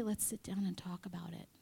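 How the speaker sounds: soft whispered invitation; gentle whisper. Retold or imitated. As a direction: whisper it gently, patient and kind. God's gentle reply voiced.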